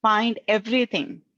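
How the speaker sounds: ordinary talk, nothing marked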